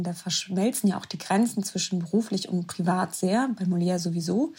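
ordinary delivery

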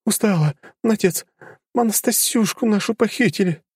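high, distressed daughter. A narrator's reading. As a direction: daughter breaking terrible news. Daughter reports kidnapping.

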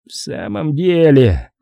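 slow, lightly sighed admission; a touch of a sigh, drawn out. A narrator's reading. Admiral conceding the weak spot, with a mild sigh. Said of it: "вздохнул адмирал".

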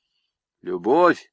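usual speaking voice

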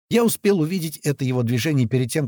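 usual speaking voice

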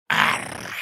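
low, loud agonized cry; pained demon cry. Acted. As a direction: cry out in agony. Demon cries out in pain.

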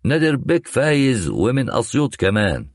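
high, pressed delivery of "نادر بيك فايز ومن أسيوط كمان"; pointed emphatic articulation. A narrator's reading. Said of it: "يضغط على مخارج حروفه".

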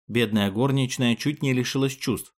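plain reading voice

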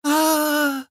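high sustained scream; long high cry; falling scream. A narrator's reading. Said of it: "только и успела прокричать Инна".

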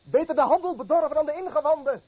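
high, loud retort; shouted defiance over the market; defiant shout. Acted. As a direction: fling it back at him. Fisherman shouts back.

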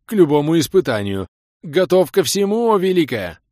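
high, loud, forced blurt; strained forced reply. A narrator's reading. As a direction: squeeze it out awkwardly. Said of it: "натужно и невпопад выдавил".

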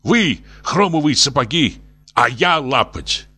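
worked up. A narrator's reading. Максимыч contrasts himself with them, worked up. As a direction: get carried away, indignant. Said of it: "вовсе разошёлся Максимыч".

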